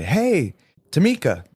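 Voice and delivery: bright, high, casual hello by name; friendly hey across the counter; cheery greeting. Retold or imitated. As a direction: call her name out warmly and brightly.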